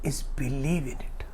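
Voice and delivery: soft whispered close to the line. Acted. Father tells panda the real secret, whispering. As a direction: drop to a hush, as if confiding. The secret's lesson, told softly.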